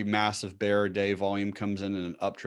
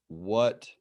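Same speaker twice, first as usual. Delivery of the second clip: ordinary talk, nothing marked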